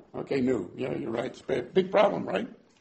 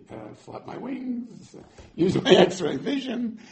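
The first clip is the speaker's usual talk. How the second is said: sarcastic question; 6 semitones higher, slower than usual. Acted. Gideon joking to God about flapping wings, sarcastic. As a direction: ask it mockingly slow.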